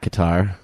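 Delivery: "Qatar" as kuh-TAR; "Qatar" rhyming with guitar; old pronunciation. Shown as a demonstration. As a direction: say "Qatar" with stress on the last syllable. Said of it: "go back to the old pronunciation".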